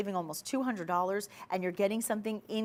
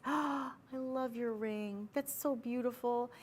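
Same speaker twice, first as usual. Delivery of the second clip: higher, softer, slow and hushed with awe; soft admiring voice; 4 semitones higher, 6 dB quieter, slower than usual. Shown as a demonstration. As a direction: sigh the compliment like you're dazzled. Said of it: "you say it in that voice".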